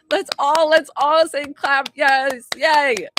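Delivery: loud, celebratory cheer; rally everyone with loud yays. Real, not acted. Streamer gets viewers to clap for her client, cheering loudly.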